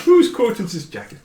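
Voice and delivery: high mock Welsh lilt, laughing; jokey amateur Welsh accent; attempted Welsh accent. Shown as a demonstration. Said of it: "Welsh accents are so hard".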